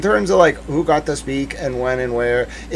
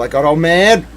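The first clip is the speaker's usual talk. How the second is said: usual speaking voice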